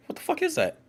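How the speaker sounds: very high, squeaky disbelief; baffled falsetto. Real, not acted. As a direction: squeak it out in bewilderment. Baffled by a mysterious ticking sound.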